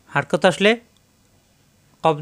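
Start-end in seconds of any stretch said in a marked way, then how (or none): none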